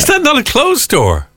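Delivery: high, insistent shouted question; shouting. Real, not acted. Co-host repeating the challenge after being queried, shouting. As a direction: press the point in a high-pitched voice. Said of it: "like a, like a woman from Manchester?".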